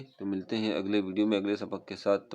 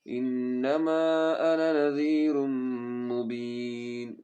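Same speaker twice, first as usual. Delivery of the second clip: melodic chanted tajweed recitation; Quran recitation; 4 semitones higher, 4 dB louder, much slower than usual. Shown as a demonstration. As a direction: recite slowly and musically, stretching vowels.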